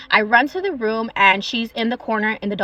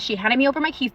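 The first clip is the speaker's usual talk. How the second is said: usual speaking voice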